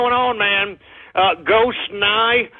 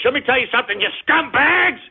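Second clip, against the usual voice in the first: higher, indignant outburst; angry outburst; 4 semitones higher, faster than usual. Real, not acted. Host berating trolls as scumbags, angry. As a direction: berate them with rising pitch.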